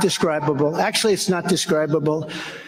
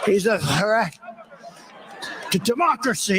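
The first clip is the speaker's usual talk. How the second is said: mocking Biden; 3 semitones higher than usual. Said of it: "He always says".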